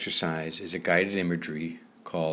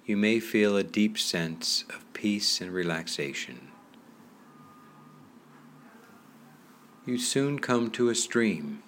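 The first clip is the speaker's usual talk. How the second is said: guided relaxation. Acted. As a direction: ease the listener into calm, unhurried. Leads a guided relaxation meditation.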